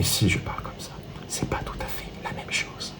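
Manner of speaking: very soft, fading into a whisper; barely audible, hushed voice; low volume demo. Shown as a demonstration. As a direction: drop right down to a whisper. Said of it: "Le volume".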